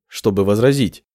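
plain reading voice

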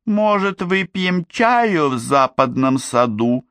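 very high, slow, airy lilt; innocent suggestion. A narrator's reading. Oblivious princess suggests tea mid-battle, innocent and high. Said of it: "невинно предложила она".

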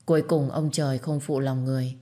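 ordinary delivery, nothing marked

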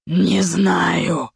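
eldritch inhuman voice with growl. A narrator's reading. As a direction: snarl it like a monster. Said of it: "нечеловеческим голосом с отголосками рычания".

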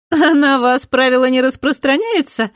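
high, loud, sly; teasing girl. A narrator's reading. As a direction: tease him, high and sly. Ада teasing Alex.

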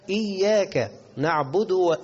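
slow, high "نعبد" ending in du; dal with damma. Shown as a demonstration. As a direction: recite "نعبد" with a clear final u. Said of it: "ده المضمومة". («نعبد» بدال مضمومة)

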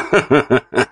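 short high laugh. A narrator's reading. Count laughing at his wife, short laugh. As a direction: burst out laughing at her. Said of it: "Рассмеялся мужчина".

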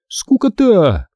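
high, drawn-out jeer; scornful dismissal. A narrator's reading. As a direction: draw it out high and bored. Sister mocks the boring books. (протяжно, с пренебрежением)

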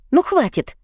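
high cry of enough; loud exclamation. A narrator's reading. Daughter silencing the quarrelling family, high loud cry. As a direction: cut them all off with a sharp high cry. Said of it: "громкое восклицание".

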